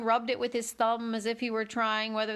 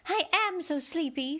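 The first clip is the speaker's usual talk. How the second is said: moaned; 6 semitones higher than usual. A narrator's reading. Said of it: "she moaned".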